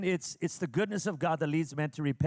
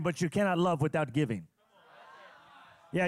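ordinary talk, nothing marked

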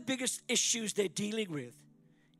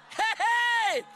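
mock call; 11 semitones higher, 7 dB louder than usual. Calls out, interrupting himself.

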